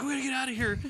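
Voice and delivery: high, loud panicked yell; mock panic. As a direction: imitate a friend losing his mind. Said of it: "he loses his mind".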